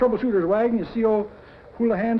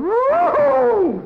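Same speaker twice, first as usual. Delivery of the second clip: much higher, louder whooping yelp; woodpecker yelp; 14 semitones higher, 5 dB louder than usual. Acted. Woody yelping as he's jabbed with the pin, whoop. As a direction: shoot up with a startled whoop.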